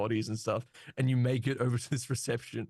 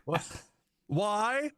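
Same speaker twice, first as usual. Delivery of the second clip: high, defensive robot voice; protest, pitched well up; indignant robot voice; 6 semitones higher, slower than usual. Acted. Thoven voiced by GM.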